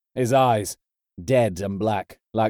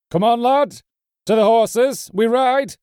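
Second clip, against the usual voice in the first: rally them to ride at full shout; high, commanding yell; urgent rallying shout; 11 semitones higher, 6 dB louder than usual. A narrator's reading. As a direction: call out high and loud to the men. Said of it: "called Hagen".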